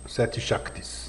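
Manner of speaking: hushed and soft, almost no voice; reverent whisper. Real, not acted. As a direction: whisper the sacred name. Sacred name said reverently. (sussurro reverente)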